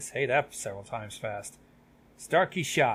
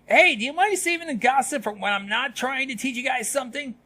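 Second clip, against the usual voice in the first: raised, high-pitched reprimand; scolding teacher; 11 semitones higher, 5 dB louder than usual. Acted. Teacher to gossiping students mid-lesson, scolding. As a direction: cut in loudly on the chatter.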